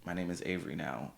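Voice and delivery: soft, easy reminder voice; gentle reminder. Pretend. Reminding a friend of a new name, gentle reminder. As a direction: remind them quietly, no edge. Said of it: "I'll just remind you".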